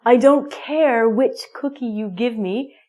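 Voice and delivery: ordinary talk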